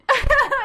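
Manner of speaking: loud high laugh; high burst of laughter. Real, not acted. Laughing at drunk French.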